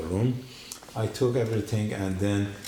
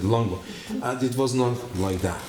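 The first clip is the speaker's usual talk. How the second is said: ordinary talk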